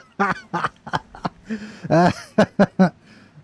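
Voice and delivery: a burst of real laughter. Real, not acted. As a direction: crack up at the screen. Streamer laughs at new character.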